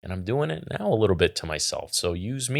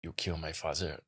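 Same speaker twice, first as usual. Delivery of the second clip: hushed, badly attempted character accent; half-hearted movie impression, barely voiced; Fezzik; 2 semitones lower, 13 dB quieter than usual. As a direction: give a poor impression under your breath. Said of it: "do it in Fezzik's voice".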